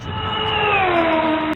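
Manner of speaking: high, loud, slow mock tough-guy shout. Mocking a tough Aussie bloke, loud high shout. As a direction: bellow it like a hard man squaring up.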